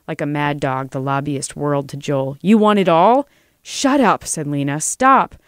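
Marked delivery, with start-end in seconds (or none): 2.4-3.3 loud, raised-pitch taunt; furious challenge. Acted. Lobbyist whirls on Joel, shouting a challenge. 3.6-4.2 high-pitched yelp to silence him; desperate shout. Acted. Lena cuts off the lobbyist, shouting high. 4.8-5.3 high, loud cry; desperate shout. Acted. Lena tries to stop the lobbyist, crying out high.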